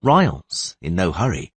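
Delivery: plain reading voice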